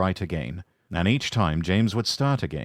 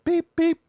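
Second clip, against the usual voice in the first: imitated whistle; 4 dB louder than usual.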